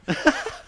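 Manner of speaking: high burst of laughter. Real, not acted. Laughs at his own retelling.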